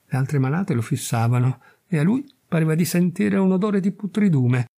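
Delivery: usual speaking voice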